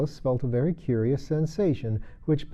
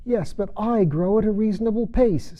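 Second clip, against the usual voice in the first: Dormouse voice; 10 semitones higher, 4 dB louder than usual. Acted. Dormouse retorts.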